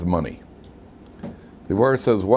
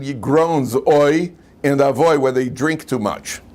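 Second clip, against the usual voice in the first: ordinary talk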